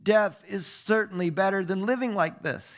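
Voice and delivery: high, dramatic cry of complaint; exclaiming. A narrator's reading. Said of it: "he exclaimed".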